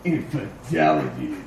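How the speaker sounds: Infidelity said very low, like a bank's brand name; deep announcer. Shown as a demonstration. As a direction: drop into a deep commercial voice. Repeating word deep for bank joke.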